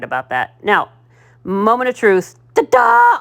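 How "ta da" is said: high, loud triumphant ta-da; excited ta-da. Real, not acted. Crafter revealing her finished bow, thrilled. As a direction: unveil it with a bright burst.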